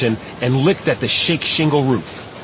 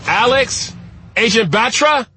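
high searching call, rising at the end; raised, questioning shout; 11 semitones higher than usual. A narrator's reading. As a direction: call out names into the open for someone missing.

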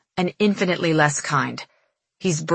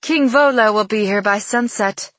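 proud; 4 semitones higher than usual. Acted. Angeline proudly announces her father.

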